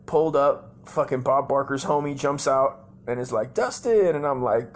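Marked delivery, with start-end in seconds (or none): 3.5-4.2 high, loud announcer-like call; calling out a name. Retold or imitated. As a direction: holler the name to summon someone. Acts out man calling him.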